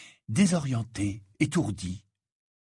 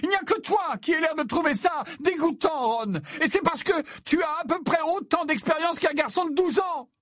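very high overwrought tirade; high-pitched distressed rage; near-tearful screaming. A narrator's reading. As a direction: scream accusations, close to tears. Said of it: "semblait à présent au bord des larmes".

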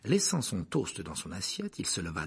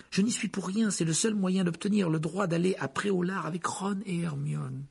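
lightly voiced, higher boy; apologetic boy; 9 semitones higher than usual. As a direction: plead your case a little.

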